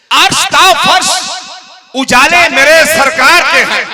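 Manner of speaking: loud, lifted naat line; verse recitation. Acted. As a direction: declaim it over the crowd.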